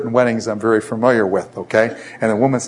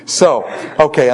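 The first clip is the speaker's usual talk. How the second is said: ordinary delivery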